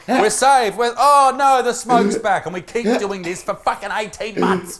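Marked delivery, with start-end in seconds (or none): 0.1-2.3 high, loud comic alarm; mock panic. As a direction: go from relief into exaggerated alarm. Acts out panic in burning house.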